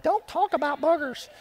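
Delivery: high, soft, laughing mock mom voice; scolding mom. Moms in the church scolding the preacher, high laughing mock tone. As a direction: playfully imitate a fussy mother. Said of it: "Some moms are in here like".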